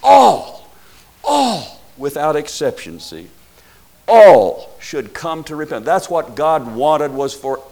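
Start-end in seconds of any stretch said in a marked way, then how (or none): 0.0-1.8 "All", high and falling, belted out; stress on ALL. Shown as a demonstration. Preacher shows the inclusive word, each "All" high and emphatic. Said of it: "those inclusive large words". 4.1-5.8 "All" loud and high, then falling; stress on ALL. Shown as a demonstration. Preacher restates the verse to listeners, "All" punched loud. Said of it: "those inclusive large words".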